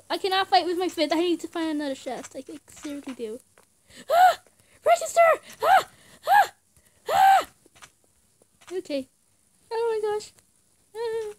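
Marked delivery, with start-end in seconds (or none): 3.8-7.5 high, loud short shrieks; startled screams. Real, not acted. Gamer shrieking as monsters attack. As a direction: shriek in fright.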